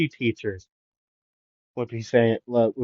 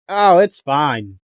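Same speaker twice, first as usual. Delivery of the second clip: drawn-out, louder and higher; mock-casual brush-off, stretched out; breezy reassurance; 5 semitones higher, 6 dB louder, slower than usual. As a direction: wave it off loudly and slowly. Voicing character's carefree reply.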